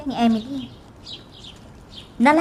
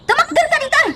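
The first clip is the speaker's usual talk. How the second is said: angry shout; 4 dB louder, faster than usual. Acted. Boss yelling at employee.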